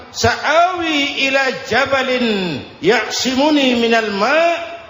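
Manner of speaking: slow, high declaimed verse; melafalkan ayat. Acted. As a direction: stretch the Arabic out as a called reply. Son's reply recited from Quran. (lafal ayat lambat, bernada tinggi)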